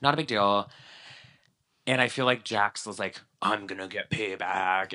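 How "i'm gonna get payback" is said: vengeful attitude. Retold or imitated. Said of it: "had the same attitude of".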